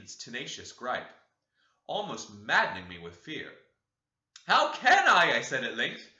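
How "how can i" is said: loud, high, startled question; frightened cry. Acted. Dreamer terrified by the ghostly hand, loud frightened cry. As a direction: blurt it out in terror.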